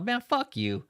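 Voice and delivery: recreated defiant outburst; retold curse. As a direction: fling it back at him in a high mock yell. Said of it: "I was like".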